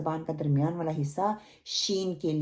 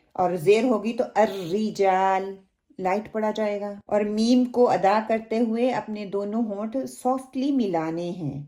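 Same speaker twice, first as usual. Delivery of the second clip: ordinary talk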